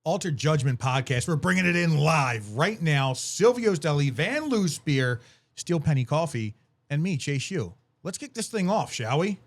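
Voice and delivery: host opening the episode and naming sponsors, announcer style; podcast announcer intro. Pretend. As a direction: kick off the show like a radio host. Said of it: "you can't do that any better".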